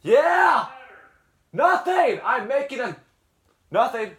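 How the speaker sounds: high, loud yell to someone far off; annoyed call across the house; real shouting back. Real, not acted. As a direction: holler back, fending off an interruption. Calling back to someone bothering him.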